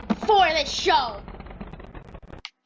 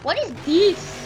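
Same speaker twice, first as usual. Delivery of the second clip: higher, confused questioning; puzzled character voice; 4 semitones higher, slower than usual. Acted. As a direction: sound baffled by the truck. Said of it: "He's like".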